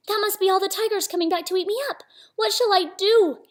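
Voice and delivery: high-pitched acted fright; panicked Samba. Little Samba fearing tigers coming back, high panicky voice.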